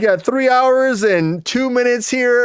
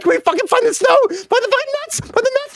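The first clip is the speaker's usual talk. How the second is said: squeaky higher squirrel pleading, voice climbing; 9 semitones higher, faster than usual. Acted. Rambling host voices squirrel begging for nuts, climbing higher. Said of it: "And the squirrel's like".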